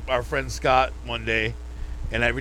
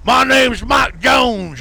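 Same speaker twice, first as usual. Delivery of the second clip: loud, high, drawn-out mock introduction; hammed-up shout; 8 semitones higher, 7 dB louder, much slower than usual. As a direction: holler it slowly like a hammed-up announcement. Mimics [name] introducing himself.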